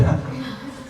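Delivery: short breathy giggle; a quick embarrassed laugh. Real, not acted. Laughing before retelling what she asked him. Laughs recalling awkward ask.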